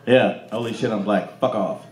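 ordinary delivery

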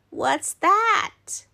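high-pitched curious little Danny; curious child. Acted. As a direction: ask high, like a puzzled child. Child asking Grandpa in story.

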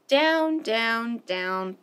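stepping down in pitch; falling step by step. Acted. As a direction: let the voice drop down with the fall. Baby bird falling from nest.

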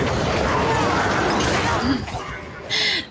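airy rising laugh; breathy laugh. Real, not acted. Streamer laughing at his own group photo joke, breathy laugh.

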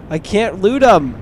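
usual speaking voice

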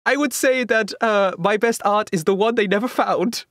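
put-on high, hammy Italian accent; sly artist in a bad Italian accent. Leonardo answers about his art.

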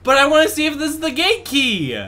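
excited. Real, not acted. Excited gamer wanting to try.

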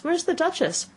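put-on high-pitched little girl question; curious Alice. A narrator's reading. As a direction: ask it with open curiosity.

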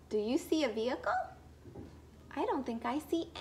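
ordinary delivery, nothing marked

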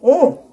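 high savoring hum; loud mm of delight. Real, not acted. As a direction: hum with pleasure at the taste. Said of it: "one more bite".

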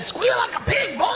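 high, hammed-up backwoods Southern holler; exaggerated high Southern twang; Southern accent. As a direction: squeal it out as a mock Georgia hillbilly. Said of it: "like South Carolina and Georgia".

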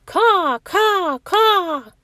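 high loud cawing crow calls; crow caws. Acted.

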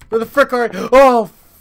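panicked cry after a jump scare; scared shout. Real, not acted. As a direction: yelp in real fright. Jump scare in horror game.

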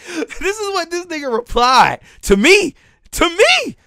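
high, loud laughing disbelief; incredulous laugh. Real, not acted.

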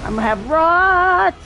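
loud, high panicked cry; alarmed outburst. Real, not acted. Gamer yelling at the screen, high and loud. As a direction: yell it out as the rot hits you. Rot infliction in boss fight.

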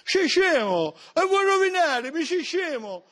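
high-pitched indignant squeal; high squeaky outrage. Acted. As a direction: squeal it out, scandalized.

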